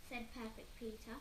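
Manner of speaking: usual speaking voice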